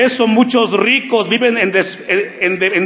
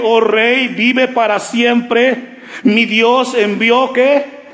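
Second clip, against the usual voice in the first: slow, raised preaching read; scripture reading; 3 semitones higher, slower than usual. Acted. Pastor reading Daniel's answer to the church, slow and raised. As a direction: draw each phrase out for the church.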